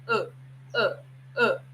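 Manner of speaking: short throaty uh, uh, uh; glottal uh sound. Shown as a demonstration. Teacher demonstrating the reduced of sound. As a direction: clip uh off in the throat. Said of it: "É um som que é assim".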